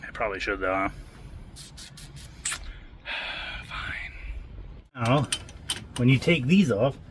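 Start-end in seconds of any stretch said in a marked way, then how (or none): 3.7-5.0 weary sigh. Real, not acted. Reluctantly gives in to cleaning.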